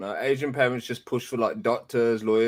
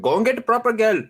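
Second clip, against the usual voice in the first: higher and louder, bossy parent impression; 10 semitones higher, 4 dB louder than usual. Pushy parent rejecting the girlfriend, higher, louder mock order. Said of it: "Even your girlfriend's like".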